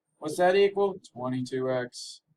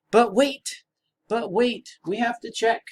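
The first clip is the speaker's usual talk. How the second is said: excited; 9 semitones higher, 5 dB louder than usual. Acted. Excited teacher interrupting himself.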